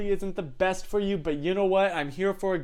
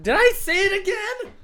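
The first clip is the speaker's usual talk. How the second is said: mock alarm; 8 dB louder than usual. Real, not acted. Mock alarm at own slip.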